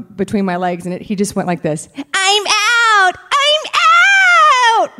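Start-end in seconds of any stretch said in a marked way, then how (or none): 1.9-5.0 high shouted cartoon puppet voice. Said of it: "With a cartoon voice".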